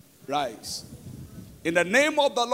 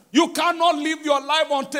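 ordinary talk

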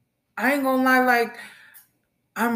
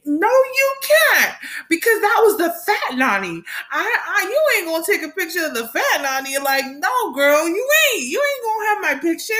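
sassy refusal; 9 semitones higher than usual. Real, not acted. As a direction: refuse with playful attitude. Refusing the ID photo.

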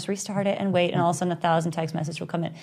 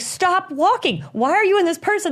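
high-pitched, frantic mock orders; worked-up, pleading imitation; retold exasperation; 11 semitones higher, 3 dB louder than usual. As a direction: act out someone losing patience on the phone.

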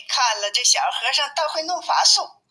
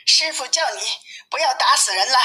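higher-pitched call to an elder brother; lightly acted calling-out, pitched up; 5 semitones higher than usual. A narrator's reading. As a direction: call out a message, mildly raised voice. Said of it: "高声叫道".

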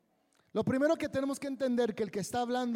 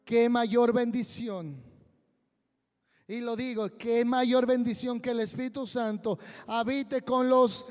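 loud, slow proclamation; fervent preaching; 7 dB louder than usual. Acted. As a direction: preach it with full force. Predicación a plena fuerza. (predicación fervorosa y fuerte)

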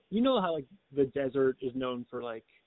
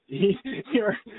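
laughing; 7 semitones higher, 5 dB louder, much slower than usual. Real, not acted. Laughing mid-theory about future self.

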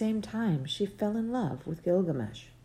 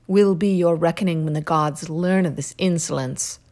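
louder, commanding goddess voice; goddess calling out; 2 semitones higher, 6 dB louder than usual. Acted. Goddess Ishtar calls to Gilgamesh, louder and commanding.

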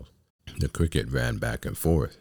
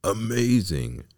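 drawn-out, higher exclamation; amazed; 7 semitones higher, 3 dB louder than usual. A narrator's reading. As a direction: stretch it out in wonder. Onlooker marvels at the puppet.

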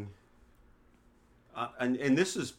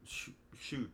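hushed self-correction; 10 dB quieter than usual. Retold or imitated.

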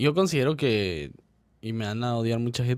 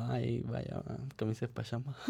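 much softer, unimpressed mumble; underwhelmed reaction; 13 dB quieter than usual. Acted. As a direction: accept the gift with little enthusiasm. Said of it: "Pero va a ser así como".

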